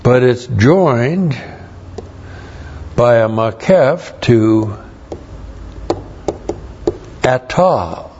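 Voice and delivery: "joined" and "makef" spelled out slowly; slow, deliberate. Shown as a demonstration. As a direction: teach it slowly, word by word. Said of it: "That's very, very important".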